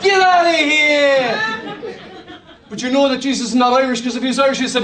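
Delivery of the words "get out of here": loud, high, slow shout; shouted rebuke. Pretend. Preacher shouting Jesus' rebuke at the devil. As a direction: throw him out hard.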